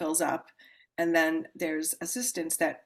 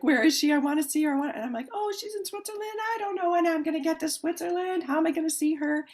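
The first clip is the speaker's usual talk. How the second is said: eager longing; 11 semitones higher, 4 dB louder, faster than usual. Retold or imitated. Retelling her eager thoughts.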